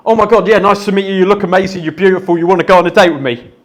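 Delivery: loud, high, breathless rush of words; rapid-fire nervous blurt. Acted. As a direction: fire it all out aggressively in one go. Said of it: "They get all their words out in about two seconds".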